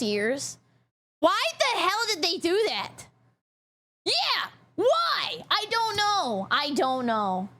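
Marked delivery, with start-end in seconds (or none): 1.2-5.4 high, exasperated whine; indignant, pitched-up why; exasperated questioning. Real, not acted. Complaining about changed software. 5.5-6.5 high, exasperated I don't know; pitched-up helpless bafflement. Real, not acted. Exasperated answer, then settles down.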